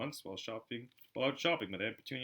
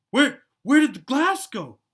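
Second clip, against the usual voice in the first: much higher, loud, slow bewildered cry; shocked stammer; 16 dB louder, slower than usual. Acted. The stunned keeper asks where the glass went. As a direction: stammer in disbelief.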